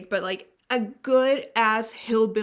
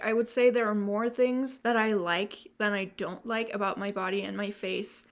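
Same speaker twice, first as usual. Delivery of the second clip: ordinary delivery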